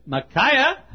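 high rising call of a name. Acted. Messenger calls down to Micaiah in jail, high calling out. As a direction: call down to someone, lifting the end high.

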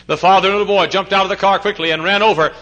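loud, high preacher's narration; urgent storytelling. Real, not acted. Preacher telling of rushing to an accident, urgent storytelling. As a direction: push it out with sudden urgency.

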